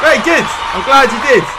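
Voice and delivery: loud, high, delighted shout; excited shout. Real, not acted. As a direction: shout your thanks back happily. Host responds to player's praise.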